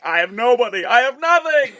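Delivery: loud, high-pitched overacted despair; mock dramatic. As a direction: wail it like a hammy movie breakdown. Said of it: "having a dramatic scene of just like".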